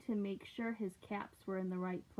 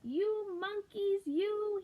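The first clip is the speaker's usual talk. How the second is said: much higher, slow, scolding; scolding peddler; 11 semitones higher, 3 dB louder, slower than usual. Acted. Peddler scolds the monkeys in the tree. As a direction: wag a finger and scold the monkeys. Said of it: "he said, shaking a finger".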